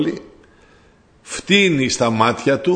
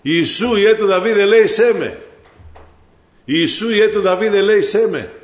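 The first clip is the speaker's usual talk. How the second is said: raised, higher-pitched plea for mercy; crying out; 5 semitones higher, 3 dB louder than usual. Acted. Preacher voices the blind man begging Jesus for mercy, crying out. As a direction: call out insistently, pitched well up. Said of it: "Και άρχισε και φώναζε".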